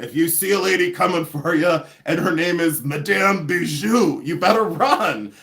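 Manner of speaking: playful put-on French accent; mock warning with a hammed-up French accent on the name; best French accent. Warning viewers about a lady coming for them, mock French accent. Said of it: "That's my best French accent".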